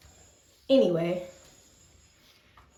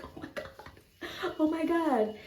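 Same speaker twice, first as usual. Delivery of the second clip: excited; 8 semitones higher, 4 dB quieter than usual. Retold or imitated. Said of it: "we were so excited".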